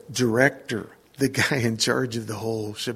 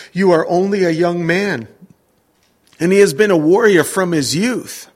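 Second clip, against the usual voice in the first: raised, louder solemn Bible reading; scripture reading; 4 semitones higher, 6 dB louder than usual. Acted. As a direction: read the verses out like from the pulpit. Pastor reads scripture aloud.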